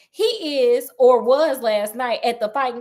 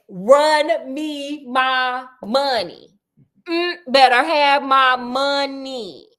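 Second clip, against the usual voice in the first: sassy demand; 3 dB louder, much slower than usual. Pretend.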